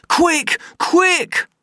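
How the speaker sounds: very high, loud urgent cries; panicked screams of quick; screaming. Acted. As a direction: scream at the police to hurry. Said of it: "screamed Bernard".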